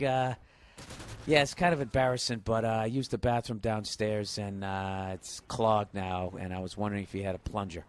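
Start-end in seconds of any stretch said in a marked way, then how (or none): 1.3-7.8 put-on low, mumbling, embarrassed young guest; lightly voiced sheepish kid asking for plunger. Comic voicing guest who clogged toilet, mildly sheepish. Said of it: "And he's like".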